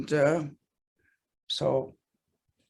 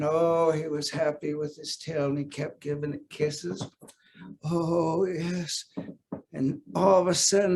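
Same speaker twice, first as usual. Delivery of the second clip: plain reading voice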